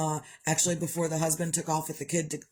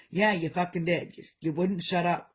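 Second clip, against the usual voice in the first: ordinary talk, nothing marked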